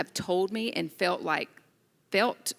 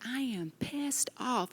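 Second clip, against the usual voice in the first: higher, slow, mock anger on "pissed off"; mock fury; 6 semitones higher, 7 dB quieter, slower than usual. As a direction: drag out "pissed off" in a light mock rage. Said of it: "I'm like".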